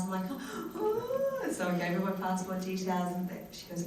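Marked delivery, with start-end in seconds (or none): 0.3-1.5 high, drawn "oh" of hope; squeaky thrilled gasp; excited gasp. Retold or imitated. Actress hears they want passport details, excited high gasp.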